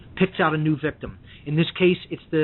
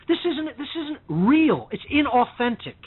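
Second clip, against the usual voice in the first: normal talking voice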